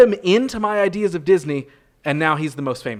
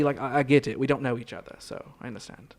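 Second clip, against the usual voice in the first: sinking lower and softer, fading to breath; deflating to a murmur; 3 semitones lower, 8 dB quieter than usual. Acted. Guest backs down into mock hurt resignation. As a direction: let the fight drain out.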